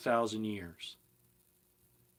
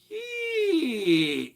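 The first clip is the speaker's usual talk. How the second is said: high loud squeaky door creak imitation; 7 dB louder than usual. Recalling the outhouse door opening, high creaking squeal. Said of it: "It was creaking. It goes".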